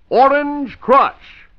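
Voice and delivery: Orange Crush sung out very high and slow; chipper 1950s ad. Shown as a demonstration. Slogan tag.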